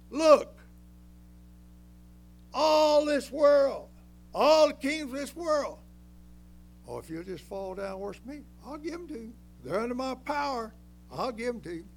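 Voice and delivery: loud, high tempter's voice calling out; preacher booming as Satan offering the kingdoms; voicing Satan. Preacher voicing Satan to Christ on the mountain, loud tempting call.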